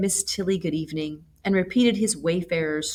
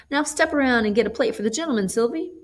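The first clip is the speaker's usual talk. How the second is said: put-on higher, quick old-woman command; brisk grandmother order; 7 semitones higher, faster than usual. A narrator's reading. Grandmother quickly telling Sylvie to fetch a plate. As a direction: hurry the girl along.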